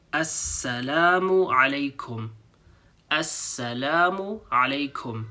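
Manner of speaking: Assalamu alaykum slowly, Assalamu stressed; slow demo. Shown as a demonstration. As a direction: model the greeting slowly. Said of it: "exactly the same as before".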